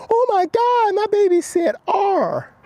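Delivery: high, squealing proud-parent voice; gushing parent. Retold or imitated. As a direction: gush like a thrilled mom over her baby. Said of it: "when the parents go".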